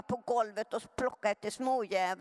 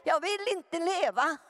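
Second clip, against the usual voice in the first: much higher, crying plea to be shot; an eleven-year-old begging her stepfather, crying out high; retold desperate cry; 9 semitones higher, 3 dB louder, slower than usual. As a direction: relive the child's tearful outcry, voice breaking. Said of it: "Jag ropar till min styvpappa".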